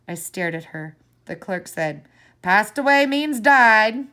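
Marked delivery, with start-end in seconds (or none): 2.4-4.1 blunt, louder and slow; impatient. A narrator's reading. Clerk explains to the child, blunt and impatient. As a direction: spell it out impatiently. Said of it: "He sounded impatient".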